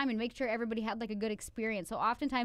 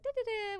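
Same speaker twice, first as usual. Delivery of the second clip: high, light "da-da-da"; airy, high-pitched pretend nonchalance; carefree filler sounds; 11 semitones higher, much faster than usual. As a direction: chirp nonsense syllables as if unbothered. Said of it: "you have to just be like".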